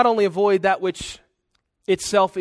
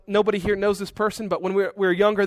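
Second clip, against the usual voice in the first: ordinary delivery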